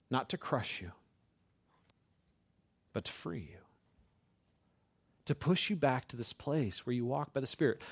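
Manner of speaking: soft, hushed let-down after intensity; gentle reassurance. Acted. Preacher voicing God freeing the believer, gently.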